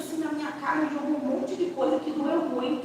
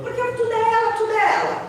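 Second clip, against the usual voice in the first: higher, louder resentful whine; jealous complaint; 10 semitones higher, 5 dB louder than usual. Retold or imitated. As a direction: mimic the jealous sister's protest. Mocking daughter's jealous complaint.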